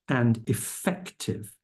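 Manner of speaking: slow, weighty "effective", each syllable given weight; stress on effective. Shown as a demonstration. Lawyer quoting the court's order, slow stressed "effective". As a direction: lean hard on "effective". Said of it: "keyword effective".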